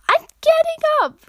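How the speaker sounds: high, loud frantic yell; acted yell. A narrator's reading. Runner yells at Katsuki after his explosion, high shout. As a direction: shout it right up at the top. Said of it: "you yelled".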